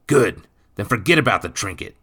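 loud, quick dismissal; stern stepfather. A narrator's reading. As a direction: close the matter briskly and loudly. Stepfather closes the quarrel.

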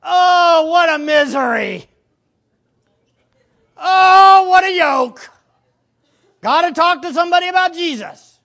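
loud, high, whiny mock lament; mock-reluctant believer groaning at being sent to witness; mock complaining. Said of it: "would you go".

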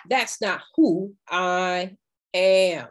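ordinary delivery

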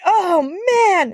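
high frustrated groan of a line; upset wail. Retold or imitated. Drunk guy in the bathroom, still high and upset. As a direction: whine it like he's had enough.

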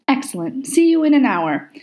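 pleased sign-off. A narrator's reading. Aziraphale pleased, ending call.

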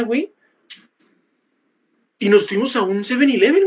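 normal talking voice

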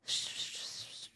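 long, soft, voiceless hiss; imitated shushing hiss. Host mimics people celebrating that snake, imitated hiss. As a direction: hiss it out quietly, drawn out. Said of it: "And they were like".

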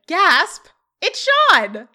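high, loud theatrical exclamation; mock shocked reveal. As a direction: announce the twist with hammed-up shock. Acted shock at killer reveal.

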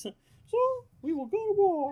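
loud, very high mock proclamation; comically high call to war; pirate declaration. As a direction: imitate a squeaky-voiced pirate lord. Imitates the same pirate's line.

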